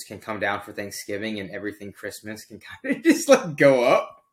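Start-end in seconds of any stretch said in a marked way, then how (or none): none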